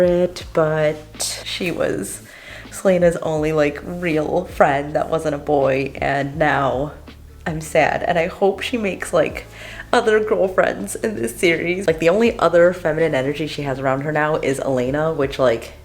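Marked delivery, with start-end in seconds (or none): none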